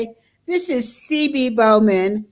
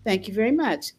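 much softer, gently polite thanks; gentle, quiet thanks; 2 semitones lower, 7 dB quieter than usual. Retold or imitated. Telling the cashier thanks, much softer and gently polite. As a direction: thank her softly and politely. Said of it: "And I said".

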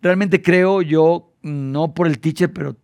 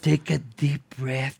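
slow, softer calming words; soothing; 6 semitones lower, 9 dB quieter, slower than usual. Retold or imitated. Host calming a trembling Britney, slow and soft. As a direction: ease her down gently, slowly. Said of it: "entonces le dije".

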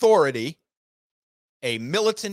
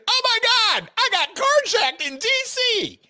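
high mock panic, a touch louder; mock scream; 4 dB louder than usual. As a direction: shriek it like a spoiled victim. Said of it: "they start screaming".

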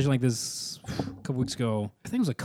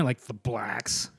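slow, sneering "the blacks"; with stank; 4 semitones lower, 9 dB quieter, much slower than usual. Shown as a demonstration. Host showing how to say "the blacks" with contempt, slow sneer. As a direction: give "the blacks" a slow, low, nasty sneer. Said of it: "put a little stank on it".

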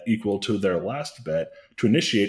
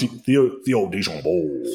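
slow, overdone New Orleans name; hammed-up New Orleans drawl; worst New Orleans accent; 3 semitones higher, 3 dB louder, slower than usual. Shown as a demonstration. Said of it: "you have to say it in your worst New Orleans accent".

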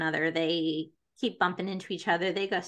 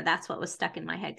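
ordinary talk

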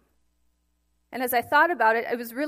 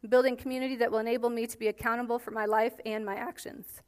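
normal talking voice

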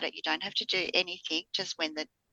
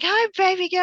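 excited greeting; 13 semitones higher, 6 dB louder than usual. Retold or imitated. Mum greets daughter's surprise call.

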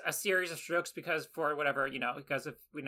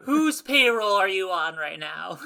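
slow, louder mock interrogation; mock suspicious; 6 dB louder, slower than usual. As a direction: grill the doctor like a paranoid boss. Joining the paranoid bit.

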